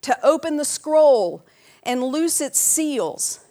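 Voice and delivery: slow, booming angel's proclamation; loud proclamation. A narrator's reading. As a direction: call it out to all heaven, slow and high. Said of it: "proclaiming with a loud voice".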